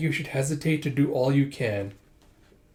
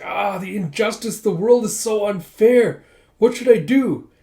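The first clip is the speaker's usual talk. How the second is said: high, loud mock wail, slowed down; melodramatic lament; 7 semitones higher, 6 dB louder than usual. Villain bemoaning unfairness to Java, mock melodramatic wail. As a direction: ham up the self-pity. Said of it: "He's being all melodramatic".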